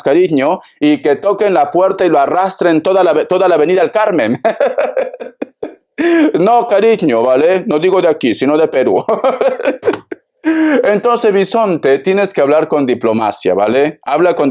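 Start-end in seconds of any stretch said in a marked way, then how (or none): 4.4-5.7 bright burst of laughter at his own joke; real amused laugh. Real, not acted. 9.1-10.1 short rising giggle at his own joke; real giggle; real laugh. Real, not acted.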